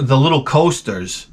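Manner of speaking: ordinary delivery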